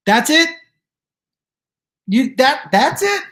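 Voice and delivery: high, loud rising squawk of disbelief; incredulous. Retold or imitated. Comedian reenacting his reply to the waiting man, incredulous. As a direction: blurt it out, utterly thrown by the anticlimax. Retelling disbelief at stalker's question.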